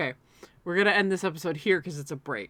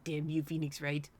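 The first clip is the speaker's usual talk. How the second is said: Milton-like voice; 3 semitones lower, 8 dB quieter than usual. Acted. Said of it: "You sounded like Milton there".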